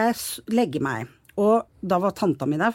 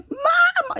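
a high, piercing cry of Mamma, recalled; retold scream for mum. Girl in panic calls her mother from bed, high shriek. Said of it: "Og jeg husker jeg hylte".

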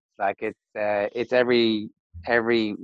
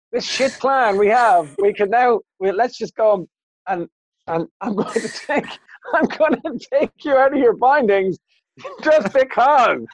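mock dim gangsters; 10 semitones higher than usual. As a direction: play the clueless thugs pitched high and dragging. Mocking gangsters' actual plan.

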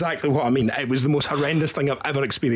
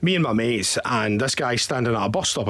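ordinary talk, nothing marked

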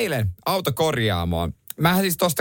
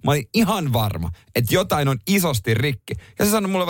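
ordinary talk, nothing marked

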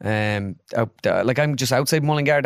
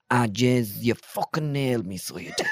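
Tommy Tiernan; 3 dB quieter than usual. Retold or imitated. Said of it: "he turns around and he's like".

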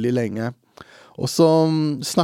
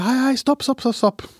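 put-on high calling out to stop the kids; retold calling out; 13 semitones higher than usual. Calling high to two small boys walking past. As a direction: raise your voice high to stop two children. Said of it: "Så jeg roper".

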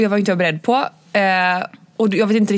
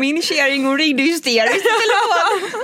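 laughing retelling; 9 semitones higher, slower than usual. Retold or imitated. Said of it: "retold voice of partner".

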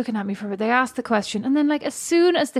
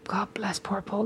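whispered; 9 dB quieter, slower than usual. Acted. Mock pity for doll boyfriend.

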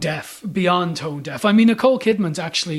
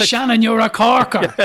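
loud, raised mock movie-hero delivery; quoted film line; 4 semitones higher, 6 dB louder than usual. Film critic quoting the missed best line to the host, loud mock film delivery. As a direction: punch it out like a favourite film quote. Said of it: "You missed the best line".

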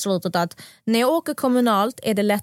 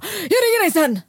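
higher, louder yell into the phone; 9 semitones higher, 4 dB louder than usual. Retold or imitated. To Nessa on the phone, hanging up with a yell. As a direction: cut the call off with a shout. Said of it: "jag vet att jag skrek till henne".

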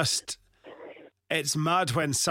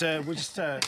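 ordinary delivery

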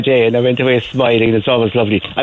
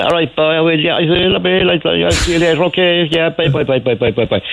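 Cork phone chatter; 6 semitones higher than usual. Retold or imitated. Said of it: "a Cork accent".

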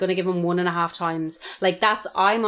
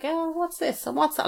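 aloof curiosity; 10 semitones higher than usual. Retold or imitated. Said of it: "completely aloof".

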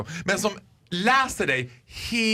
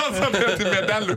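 ordinary talk

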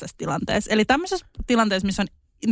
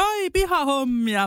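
slow, higher cheery greeting; a neighbour sings out a friendly hello, slowly; 5 semitones higher, slower than usual. Retold or imitated. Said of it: "naapurikin sieltä". (naapurin hidas, pirteä tervehdys)

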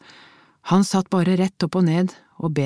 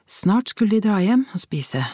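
ordinary delivery

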